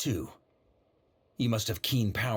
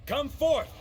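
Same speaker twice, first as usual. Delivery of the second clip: loud summons; 4 dB louder than usual. Acted. Summoning Boreas, a high ringing command.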